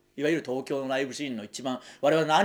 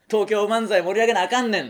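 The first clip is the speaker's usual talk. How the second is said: mock Kansai dialect zeal; 7 semitones higher, 5 dB louder than usual. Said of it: "の人になってましたから".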